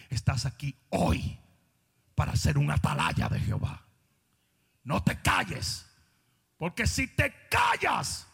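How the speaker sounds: slow emphatic shouted preaching; building sermon. Acted. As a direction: build it to a climax. Exhorting congregation.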